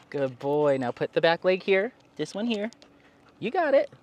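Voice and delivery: high, upbeat encouragement to a pet; coaxing dog talk. Real, not acted. Owner coaxing the dog up the steps, high and encouraging. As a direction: cheer the dog on, voice lifted.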